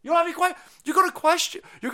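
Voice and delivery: loud, high incredulous rant; furious disbelief at the outlet; incredulous outburst. Real, not acted. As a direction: demand answers in outrage.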